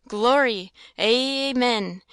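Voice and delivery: loud, falling "Glory, amen" like a fervent crowd; crowd shouting. A narrator's reading. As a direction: call it out like a revival congregation. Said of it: "And the people would shout".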